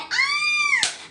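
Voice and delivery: high squeal of delight on ah; excited squeal. Real, not acted. Gushing to viewers about the dad, delighted squeal. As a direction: gush over the dad.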